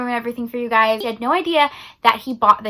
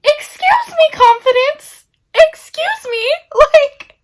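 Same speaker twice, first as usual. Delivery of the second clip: much higher and louder, gushing excuse me; breathless delighted shriek with a laugh at the end; swooning squeal; 5 dB louder, slower than usual. Real, not acted. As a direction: squeal like a fangirl over the hero. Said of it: "swooning reaction to the hero".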